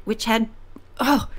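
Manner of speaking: ordinary delivery, nothing marked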